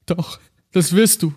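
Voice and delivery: high, tearful insistence; holding back sobs. Acted. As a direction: sob it out. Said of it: "schluchzte er".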